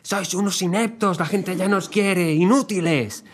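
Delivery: hammed-up scolding at high pitch; imitating angry shouting. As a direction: berate them like a furious voice note. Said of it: "Nota de audio gritando".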